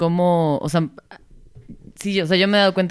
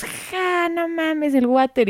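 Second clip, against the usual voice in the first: much higher, scoffing laugh-voice; mocking friends; 11 semitones higher, much slower than usual. Friends ridiculing her word for the toilet, high scoffing mimicry. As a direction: jeer at the word like teasing friends. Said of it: "y todos".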